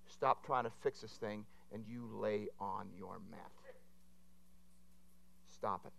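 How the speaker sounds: low, soft and very slow instruction; slow right down, order it softly; quiet firm command. Retold or imitated. Friend prophesying over the preacher by phone, quietly firm.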